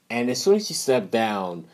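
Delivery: ordinary talk, nothing marked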